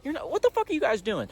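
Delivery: high, incredulous mock outburst; half-laughing disbelief. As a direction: scold while half laughing at them. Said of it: "she's almost laughing at us".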